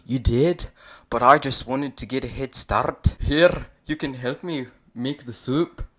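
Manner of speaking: good-natured ogre. Acted. Shrek asking you to help make soup, good-natured. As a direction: cajole your sweetheart good-naturedly. Reader voices chuckling Shrek.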